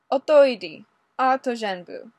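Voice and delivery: loud, high angry shout in Russian. Acted. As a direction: yell it at him, finger jabbing. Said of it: "stabbed a finger at Ray and shouted".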